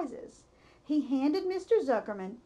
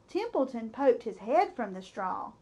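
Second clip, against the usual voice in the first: ordinary delivery, nothing marked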